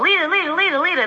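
high, eager calls of coworkers chasing her; eager calling. Retold or imitated. Coworkers trailing her down the hall, eager high calls. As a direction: chant it like needy coworkers.

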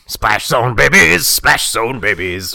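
slow, drawn-out gruff chant; gruff old man. Acted. Splash zone babies announcing themselves, slow and gruff. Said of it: "like, gruff old men?".

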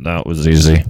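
blasting right into the microphone; very loud, overdriven Easy button imitation; distorted button impression. Shown as a demonstration. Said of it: "really close to the microphone".

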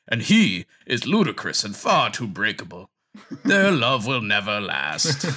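hammy Ren Fair troll voice; old-timey troll accent. Retold or imitated. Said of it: "generic old, old-timey accent".

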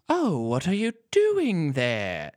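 slow, put-on mid-Atlantic accent; old Hollywood mid-Atlantic drawl. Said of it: "a mid-Atlantic accent".

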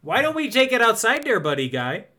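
high, lilting Wisconsin challenge; Wisconsin accent. Acted. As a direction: pick a fight in a folksy Wisconsin accent. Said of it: "if he was in Wisconsin".

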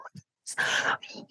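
hushed, breathless whisper; stunned whisper. Retold or imitated. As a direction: whisper it in shock during the hug. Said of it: "And I was like".

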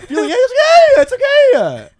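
high, loud laughing reassurance; laughing comfort. Real, not acted. Comforting his tearful friend, high laughing reassurance. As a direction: laugh while soothing a crying friend.

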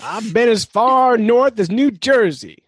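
high, drawn-out mock grandeur; mock boasting. Host teases guest about exotic lands, mock boastful.

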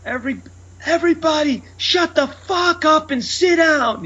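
high-pitched comic mock yell, drawn out; retold yelling. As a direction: yell a stretched-out order at a milling crowd. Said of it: "I'm yelling at them".

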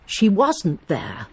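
"She wasn't there" with "wasn't" hit, slow and knowing; stress on wasn't, confirming. Shown as a demonstration. Miss Marple shows the suspicious reading, stress on wasn't. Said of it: "as if to confirm some suspicion".

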